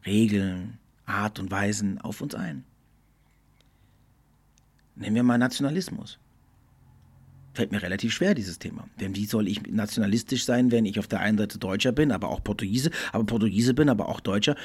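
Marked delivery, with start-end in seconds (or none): none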